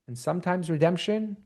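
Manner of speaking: ordinary talk, nothing marked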